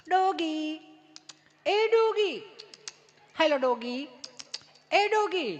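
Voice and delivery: high coaxing calls to a dog; mock sweet dog calling. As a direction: coo at the dog like a city tourist.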